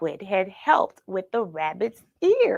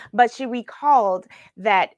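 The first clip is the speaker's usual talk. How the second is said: ordinary delivery, nothing marked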